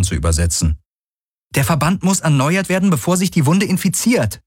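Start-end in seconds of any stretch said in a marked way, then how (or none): 1.5-4.4 high, fast, insistent; pressing, high-voiced order; urgent insistence. A narrator's reading. Heroine insists on treating the wound.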